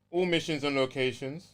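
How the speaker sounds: ordinary talk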